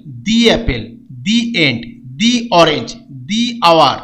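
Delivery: দি said "dee" before vowels. Shown as a demonstration. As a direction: say দি with a long ee before each vowel word. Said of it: "দি উচ্চারণ হবে, দি বলবো".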